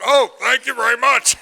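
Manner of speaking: high, coy, laughing thank-you; mock flattered reply.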